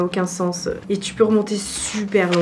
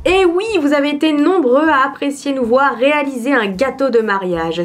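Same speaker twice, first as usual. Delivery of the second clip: voice-over read; 3 semitones higher than usual. Acted. Records her intro voice-over.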